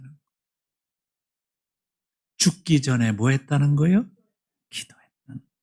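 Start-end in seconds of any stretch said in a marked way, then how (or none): 4.7-5.6 soft whispered answer. Acted. Pastor answering his own question to congregation, hushed whisper.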